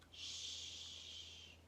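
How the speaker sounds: soft unvoiced shush. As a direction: hush them to sleep. Hushing at bedtime.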